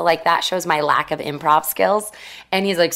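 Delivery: usual speaking voice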